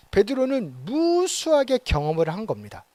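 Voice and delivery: normal talking voice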